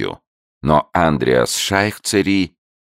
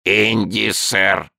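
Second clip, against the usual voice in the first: higher creaking creature voice; salamander voice; 7 semitones higher, much slower than usual. A narrator's reading. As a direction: answer like a polite newt. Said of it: "сказала саламандра".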